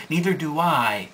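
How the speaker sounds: normal talking voice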